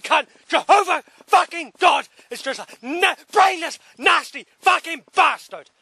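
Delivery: real rage boiling over; furious shout. Real, not acted. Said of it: "I'm sorry I'm losing my temper".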